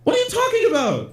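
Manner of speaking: high-pitched astonished question; incredulous outburst. Real, not acted. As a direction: question it in total disbelief. Stunned by the admission.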